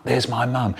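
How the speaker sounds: plain reading voice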